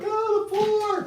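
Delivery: very high, slow squeaky panic; panicked iguana voice. Acted. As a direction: voice the lizard freaking out. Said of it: "He's like".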